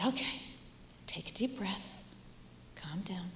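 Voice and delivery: put-on hushed, gentle whisper to soothe a child; soft calming whisper. Teacher soothing a crying pupil, soft whisper.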